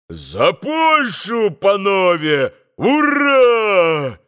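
slow rising toast into a high loud hurrah; shouting toast. A narrator's reading. Mitya shouting a hurrah to Poland. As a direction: bellow the cheer. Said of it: "прокричал Митя".

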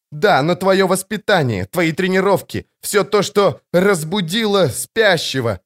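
high, agitated protest; near hysteria. A narrator's reading. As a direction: argue heatedly, losing control. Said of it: "он вот-вот сорвётся в истерику".

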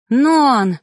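loud, high cry of his name; rushing to the hurt man, a high, loud, frightened cry; alarmed cry. Acted. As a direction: call out to him in fright. She rushes to him in alarm.

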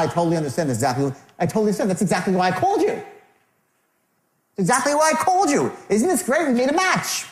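hammed-up pushy salesman delight; wrong over-eager tonality. Shown as a demonstration. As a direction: build from brisk to gushing, stretched high glee. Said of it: "not, it's not".

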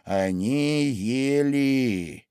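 very slow, pausing Dormouse; thoughtful Dormouse. A narrator's reading. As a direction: ponder each word slowly. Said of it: "подумав".

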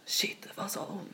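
hushed, shocked whisper. Retold or imitated. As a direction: gasp it out as stunned girls. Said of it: "de blir så sjukt så här".

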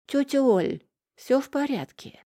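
высокий голосок мальчика; спроси как маленький ребёнок; детский голос. Acted. (высокий детский голос)

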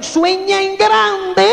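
slow, drawn-out slogan; mock guru slowly pronouncing the motto; grand mock slogan. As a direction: intone it like a hollow sales mantra. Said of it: "como le dijeron".

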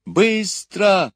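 high, loud urgent order. A narrator's reading. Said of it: "повторил доктор". (срочный высокий выкрик)

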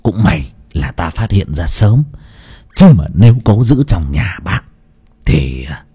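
a lightly voiced old sorcerer, hushed and low; a master lowers his voice to deliver doom; low grave fortune teller. A narrator's reading. Sorcerer tells the father his daughter is cursed, hushed and grave. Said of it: "quay sang thầy trầm giọng".

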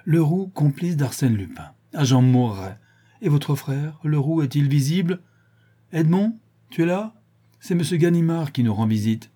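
lightly voiced inspector shaking with laughter; hilarity. A narrator's reading. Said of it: "dans une crise d'hilarité".

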